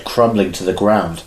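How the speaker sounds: ordinary talk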